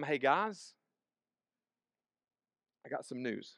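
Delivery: quiet, low, with long pauses; subdued bad-news delivery. Leader telling his followers he is leaving, subdued. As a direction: break it gently, in a hushed low voice.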